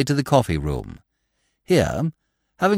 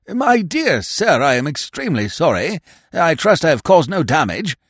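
put-on high, fluttery elderly toff; absent-minded old earl; 6 semitones higher, 4 dB louder than usual. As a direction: dither like a vague old lord. Vague earl apologizes.

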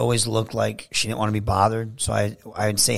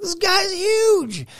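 much higher, slow, drawn-out amazement; awed; much slower than usual. Retold or imitated. Meeting Heath for the first time, much higher, slow and awed. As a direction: stretch it out in stunned disbelief.

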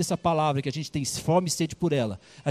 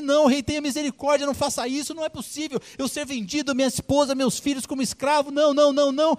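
much higher, begging for mercy; a desperate plea, voice pitched up; pleading servant; 9 semitones higher than usual. Acted. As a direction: servant begging the king not to sell his family. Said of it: "esse servo então clamou".

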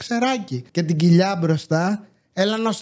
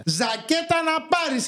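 nagging mother; 10 semitones higher, 3 dB quieter, slower than usual. Retold or imitated. Mimics mother's nagging line.

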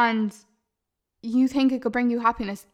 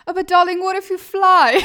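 much higher and louder, gushing and laughing; grand theatrical darling; 7 semitones higher, 8 dB louder than usual. Pretend. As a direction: play a dramatic diva reassuring someone. Theatrical reply to a fear.

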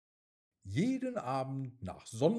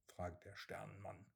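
ordinary delivery

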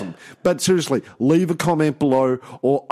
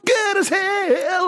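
belted high shout of the title; sing-shout it like the chorus; much slower than usual. Acted. Shouting song title back.

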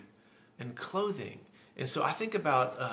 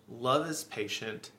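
usual speaking voice

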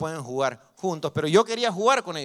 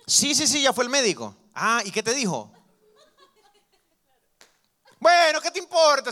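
annoyed snap; 10 semitones higher, 6 dB louder than usual. Retold or imitated. Liar annoyed by questions.

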